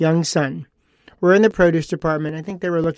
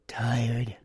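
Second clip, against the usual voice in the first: whispered, much softer and lower one-word droop; deflated whisper; 6 semitones lower, 9 dB quieter than usual. Retold or imitated. Host imitating a young woman's reply, whispered and deflated. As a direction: let the air out of the room.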